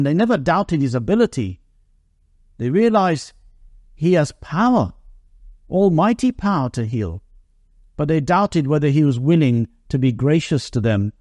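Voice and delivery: ordinary delivery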